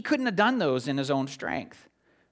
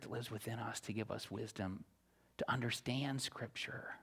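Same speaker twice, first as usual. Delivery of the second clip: whisper; 6 semitones lower, 16 dB quieter than usual. Real, not acted. Preacher ending his appeal to the congregation in a whisper. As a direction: let it fade to a whisper. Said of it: "unvoiced whisper".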